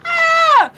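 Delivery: loud, very high squeal; piercingly high, gleeful cry; laughing shriek. Real, not acted. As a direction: let out a high "Ah!" after laughing. Shrieks after laughing fit.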